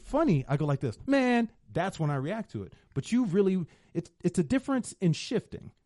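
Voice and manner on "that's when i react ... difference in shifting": normal talking voice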